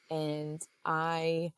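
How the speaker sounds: ordinary talk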